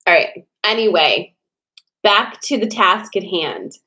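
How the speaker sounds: ordinary talk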